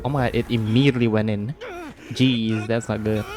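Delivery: usual speaking voice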